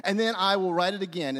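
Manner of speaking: ordinary delivery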